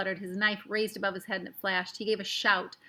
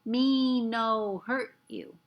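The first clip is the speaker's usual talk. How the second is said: very slow, broken words; mock Indian English; 2 semitones higher, 4 dB louder, much slower than usual. A narrator's reading. Omri trying Indian English on the tiny Indian, very slow. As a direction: spell out each word like to a foreigner. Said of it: "in what he supposed was Indian English".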